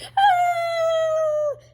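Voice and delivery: piercing high frightened scream. Retold or imitated. Scene: kid being swung at with a bat, high shriek.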